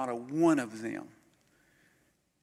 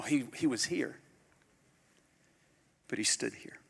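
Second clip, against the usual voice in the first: normal talking voice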